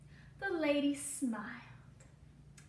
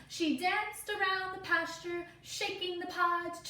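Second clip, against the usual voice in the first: gentle storybook read-aloud for a class; reading to children; 7 semitones higher, 5 dB louder than usual. Acted. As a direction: tell the story slowly to little listeners. Reading picture book to class.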